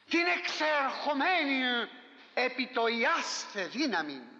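very slow, weighty questions; slow solemn questioning. Real, not acted. Preacher asking the congregation if they know Christ, slow and solemn. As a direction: draw each word out as a solemn challenge.

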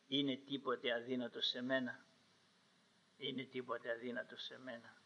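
low, very soft divine question; steady him softly, fading on the repeat; quiet reassurance. Retold or imitated. God gently strengthens Moses.

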